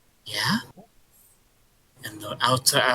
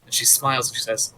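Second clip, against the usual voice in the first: ordinary talk, nothing marked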